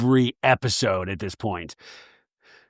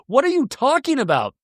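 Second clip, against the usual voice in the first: exasperated; 9 semitones higher than usual. Real, not acted. Exasperated rant at the show.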